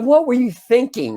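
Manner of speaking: high-pitched, exasperated question; incredulous scolding. Retold or imitated. Boy berating his friend after the prank, high incredulous scolding.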